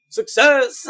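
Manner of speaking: high, loud victory call; mock triumphant cheer. Shooter after beating fifteen seconds, exultant mock cheer. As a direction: call it out like a drill just won.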